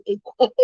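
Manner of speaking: put-on ekwang in her kids' American accent; like her kids say it. Mother mimicking her kids' ekwang. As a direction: imitate the children's ekwang. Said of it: "I said it like my kids say".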